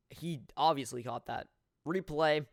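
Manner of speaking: normal talking voice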